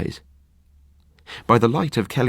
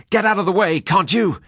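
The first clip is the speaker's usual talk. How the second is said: shouting; 11 semitones higher than usual. Acted. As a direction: yell at the figure blocking the path.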